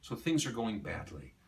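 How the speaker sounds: usual speaking voice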